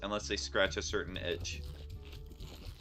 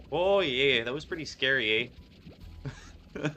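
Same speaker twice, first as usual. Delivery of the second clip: put-on loud, hammy Canadian accent; 5 semitones higher, 5 dB louder than usual. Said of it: "I nearly did a Canadian accent".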